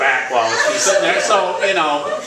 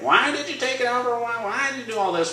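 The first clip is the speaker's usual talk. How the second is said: much higher, softer mock-accusing sister; higher imitation of the upset sister; imitating his sister; 10 semitones higher, 4 dB quieter than usual. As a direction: whine her reproach back at himself. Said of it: "She's gonna be like".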